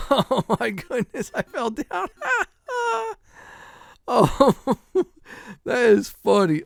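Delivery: cracking up at the fall; laughing hard. Real, not acted. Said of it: "This is real funny at this point".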